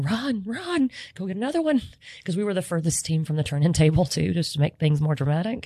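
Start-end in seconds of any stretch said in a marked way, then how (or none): 0.0-1.8 high hurried mock yell; urging the sous chef to run; mild high-pitched yell to run; retold yelling. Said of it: "I immediately started yelling".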